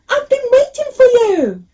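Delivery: delighted. A narrator's reading. Old man thrilled to meet Ganesha.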